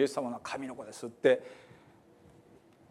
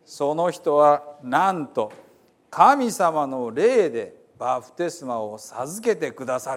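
slow, louder dramatic reading in Hiroshima dialect; earnest folksy Bible reading; dialect scripture reading; 5 dB louder, slower than usual. Acted. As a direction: proclaim the Gospel like a storyteller from Hiroshima. Reads Gospel in Hiroshima dialect.

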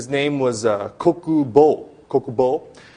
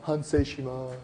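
insincere apology; 7 dB quieter than usual. Retold or imitated. Preacher showing what repentance is not, insincere apology. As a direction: drag it out, half-hearted. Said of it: "Repentance is not".

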